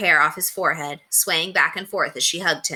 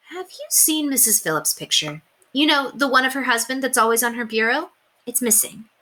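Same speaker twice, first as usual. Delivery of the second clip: gentle mother; 7 semitones higher than usual. A narrator's reading. Mother asks at bedtime.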